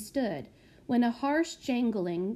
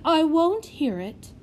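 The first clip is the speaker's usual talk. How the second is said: fawning matron; 7 semitones higher, 7 dB louder than usual. Acted. Matron cutting him off.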